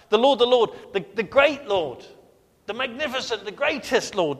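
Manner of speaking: loud, high exultant proclamation; emphatic psalmist. Retold or imitated. Psalmist exalting the Lord, loud and exultant. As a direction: cry out the Lord's greatness. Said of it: "He's like".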